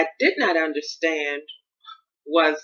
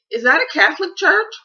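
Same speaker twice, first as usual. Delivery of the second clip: high, bright-eyed innocent asking, noticeably louder; raised, earnest question; naive question; 13 semitones higher, 5 dB louder than usual. Retold or imitated. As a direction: ask it like a hopeful newcomer, pitched way up. Retelling her own naive question.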